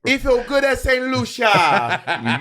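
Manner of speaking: high, drawn-out Caribbean accent imitation; teasing, high-pitched Saint Lucian accent; mock Saint Lucian accent. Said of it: "where that little accent came from".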